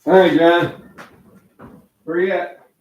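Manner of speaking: calling his son to breakfast. Real, not acted. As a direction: shout for him from the kitchen. Calling son from another room.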